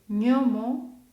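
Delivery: ñomo with gn said like Spanish ñ; Italian gn sound. Shown as a demonstration. Teacher models Italian gn like Spanish ñ. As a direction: say ñomo as nyomo. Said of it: "la primera palabra la diré".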